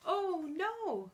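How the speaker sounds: high storybook "oh no"; dismayed. Acted. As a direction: show mild duckling dismay, swooping down. Duckling finds rain has stopped.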